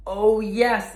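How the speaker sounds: high, loud excited squeal; thrilled at the treasure sign; exclaiming. Acted. Gruntley exclaims to himself about the treasure, excited. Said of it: "exclaims Gruntley".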